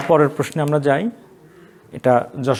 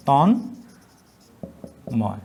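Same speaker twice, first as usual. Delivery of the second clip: Tanmoy drawn out slowly; dictating Tanmoy slowly; slow dictation; 2 semitones lower, much slower than usual. Shown as a demonstration. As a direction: stretch Tanmoy for writing. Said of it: "dictating word slowly".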